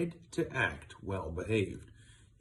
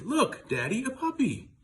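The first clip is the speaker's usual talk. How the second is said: put-on much higher, louder, thrilled little boy; excited child's shout; 5 dB louder than usual. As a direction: call out to Daddy in delight.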